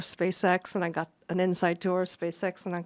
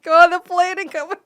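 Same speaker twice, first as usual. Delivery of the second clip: laughing; 8 semitones higher, 10 dB louder than usual. Retold or imitated. Laughing retelling.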